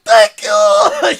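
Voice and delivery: very loud, ecstatic shout; flipping out. Retold or imitated. Prize winner thanking the caller, flipping-out shout. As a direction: shout it out, overwhelmed with excitement. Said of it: "He's literally flipping out".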